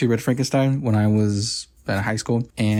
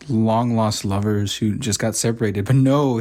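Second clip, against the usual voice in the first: ordinary talk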